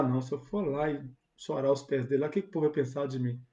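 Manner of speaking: low, soft, hesitant inner voice; hushed fearful thought. Retold or imitated. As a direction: voice her doubt quietly, as if afraid to be heard. Woman's imagined fearful thought. (pensamento baixo e receoso, quase sussurrado)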